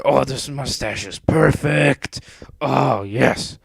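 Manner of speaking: hammy vain villain; self-admiring villain. A narrator's reading. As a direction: revel in your own looks.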